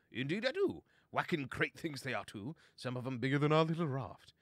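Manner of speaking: put-on high, exclaiming old-chap voice; jolly gentlemanly chum. Bo tells Verg about the huge fenders, jolly and high-pitched. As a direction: marvel like an excitable gentleman.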